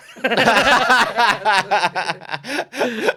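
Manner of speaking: big high burst of laughter; helpless high laugh at the hostel story; loud laughter. Real, not acted.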